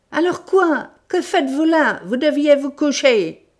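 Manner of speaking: loud, high exasperated demand; angry scolding. Acted. Sholmès grilling Wilson, angry scolding. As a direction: snap the questions at him.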